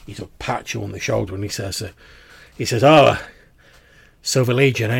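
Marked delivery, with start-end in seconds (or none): 2.8-5.0 louder, higher in-character greeting; friendly conductor. Acted. Conductor recognises a fellow legion veteran, friendly and louder. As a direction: greet an old comrade with sudden interest. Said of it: "his whole demeanour seems to soften".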